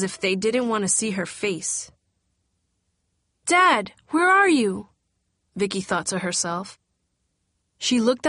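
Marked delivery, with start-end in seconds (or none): 3.5-4.9 desperate cry. A narrator's reading. Vicky desperate for her father.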